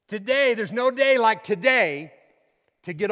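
loud, high fervent preaching; fiery pulpit preaching; preaching at full force. Acted. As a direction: drive it home to the congregation.

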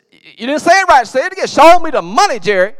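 very loud, high, hollered order; demanding full-force yell. Acted. Rod makes Jerry say it right, full-force yell. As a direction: drill him by hollering it at full blast.

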